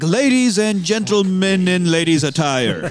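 high, slow, grand mock announcement; imitated party announcer. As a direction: proclaim it like a showy host, laughter close behind. Quoting uncle announcing at party.

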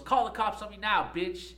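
high, loud defiant yell; imitating the student yelling a dare. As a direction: shout the dare after hurling the chair. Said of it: "And she goes".

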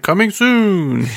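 slow, drawn-out teaser voice; mock announcement. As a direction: drag it out like a trailer tease. Mocking studio's vague announcement.

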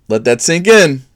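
normal talking voice

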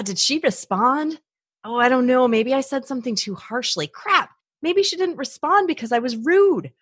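high, agitated self-questioning; mock worry spiral. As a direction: act out racing anxious thoughts, pitched high. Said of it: "things going through my head".

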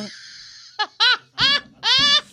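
loud high burst of laughter; big laugh. Real, not acted. Listener cracking up at the failed trust fall, shrieking laugh. Laughing at nobody catching him.

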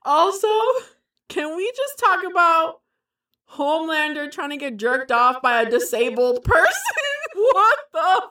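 giggly high voice. Real, not acted. Laughing over the absurd scene.

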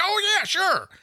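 put-on old guy voice. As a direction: squeak it up like an eager old guy. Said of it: "And he's like".

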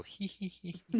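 put-on girls' giggle. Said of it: "all the girls go".

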